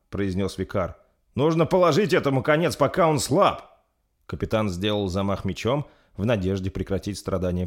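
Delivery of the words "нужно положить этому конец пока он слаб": high, loud, pressing urgency; raised voice in a crisis; urgent command. Acted. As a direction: urge comrades to act right now. Captain urges killing stricken prince.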